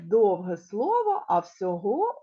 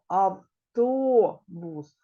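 автобус with a long loud О in the middle; slow автООбус so the vowel stands out; highlighting the o sound; 5 dB louder, much slower than usual. Shown as a demonstration. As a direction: stretch the «то» of автобус. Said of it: "Після нього йде звук".

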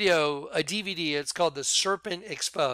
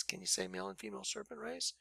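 softer, fast throwaway repeat; quick quiet aside; 4 semitones higher, 15 dB quieter, faster than usual. Shown as a demonstration. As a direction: toss it off under your breath, quickly.

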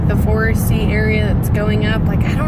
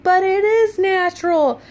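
raised, higher retort at the phone; retold yell at phone; 8 semitones higher than usual. Irritated woman yelling at a Facebook video. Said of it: "and so I yell at my phone".

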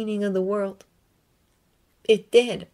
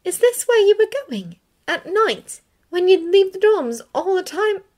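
put-on higher, louder relieved young woman; Hermione's voice; 12 semitones higher, 6 dB louder than usual. A narrator's reading. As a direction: call out with relief, pitched up.

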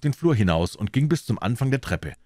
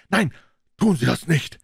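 higher, urgent burst of alarm; alarmed, raised-pitch protest; blurted plea; 8 semitones higher, 3 dB louder than usual. A narrator's reading. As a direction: force it out in a panic. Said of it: "stieß er hervor".

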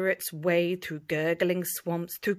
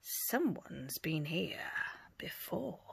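slow, hushed whisper; 3 semitones lower, 10 dB quieter than usual. Acted. Pirate whispering to the crew about footprints. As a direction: breathe it out warily.

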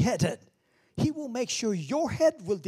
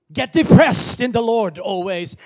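loud, higher mocking misquote; 5 semitones higher, 17 dB louder than usual. Shown as a demonstration. Preacher shouting a mock misquote at the congregation. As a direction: belt out the wrong verse. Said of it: "now I'm saying it again, depressed".

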